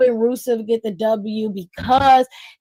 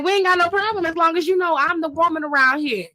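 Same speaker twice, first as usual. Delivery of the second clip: higher, put-on boastful voice; mock Asuka; 5 semitones higher, faster than usual. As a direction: claim the throne in a pitched-up voice. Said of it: "Asuka was like".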